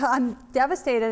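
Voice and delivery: ordinary talk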